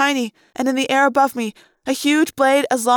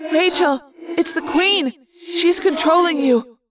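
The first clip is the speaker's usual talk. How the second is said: higher, echoing urgent call; urgent warning; 5 semitones higher than usual. A narrator's reading. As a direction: warn a friend in alarm. Urgent warning to controlled friend.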